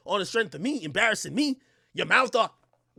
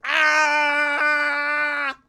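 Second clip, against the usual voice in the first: mimicking her open mouth; 7 semitones higher, 4 dB louder than usual. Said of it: "she's like".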